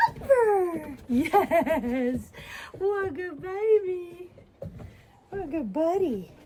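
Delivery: puppy praise voice. Real, not acted. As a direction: gush over the puppy. Calling then praising puppy.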